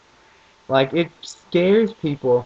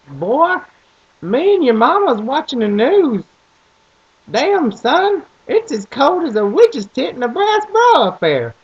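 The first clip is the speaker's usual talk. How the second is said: dad's thick Southern twang; 12 semitones higher, 5 dB louder than usual. Retold or imitated. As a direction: bellow it like a rural Southern father on the phone. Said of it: "that is really how he sounds".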